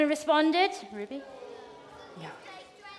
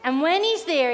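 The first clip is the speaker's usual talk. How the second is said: ordinary talk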